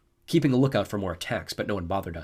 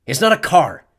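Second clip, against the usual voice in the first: growled; 8 semitones higher, 12 dB louder than usual. A narrator's reading. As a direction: growl it at him, annoyed. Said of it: "Clarice growled".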